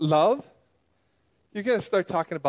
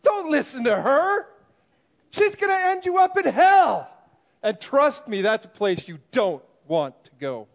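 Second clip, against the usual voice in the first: angry rant; 6 semitones higher, 5 dB louder than usual. Acted. Rival pastor angrily rebuts Grace.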